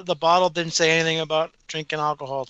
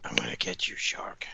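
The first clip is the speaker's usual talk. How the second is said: whisper; 6 dB quieter than usual. Pretend. Whispered movie threat lines.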